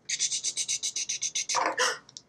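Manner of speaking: drawn-out mock gasp of wonder. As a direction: gasp as the gadget appears. Anne produces the adapter.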